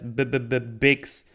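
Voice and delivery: imitating a stuttering little droid; stuck syllable, then the name bursts out. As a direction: stammer on the first sound, then get it out. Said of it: "then B says".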